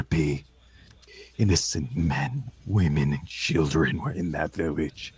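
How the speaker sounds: low, hushed, grieving fury; soft, grave accusation; contained raging fury. Acted. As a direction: seethe quietly at the killer. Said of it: "burn with a raging fury".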